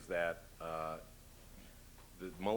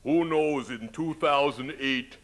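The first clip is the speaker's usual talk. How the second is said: higher, louder, slow boastful governor voice; imitating Jesse Ventura; 4 semitones higher, 7 dB louder than usual. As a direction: swagger through it like the wrestler governor. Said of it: "he said something about".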